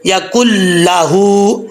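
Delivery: یا کل لہو slowly, ک joined straight to ل; slow correct reading; tajweed pronunciation. Shown as a demonstration. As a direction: drop the نون, say کل لہو. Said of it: "ایسا نہیں پڑھنا".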